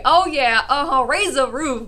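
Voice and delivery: loud, re-enacted dance-floor hype; acted-out party whooping; rowdy party cheer. Acting out her rowdy dancing.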